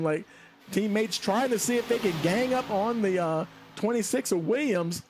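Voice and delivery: lap-by-lap commentary over the last lap; race play-by-play. Acted. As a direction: keep the final lap tense and quick. Calling final lap battle.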